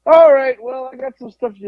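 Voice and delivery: high, loud rushed exit line; eager to leave. Retold or imitated. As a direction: jump in to escape the call. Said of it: "You're like".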